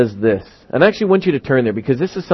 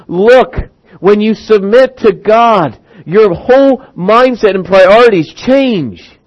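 high, slow, weighty urging; emphatic exhortation; 7 semitones higher, slower than usual. Acted. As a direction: drive each phrase home as James. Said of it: "James is trying to give us this concept of going".

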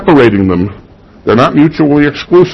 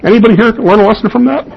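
teasing; 11 semitones higher, faster than usual. Real, not acted. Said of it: "Where's my mirror?".

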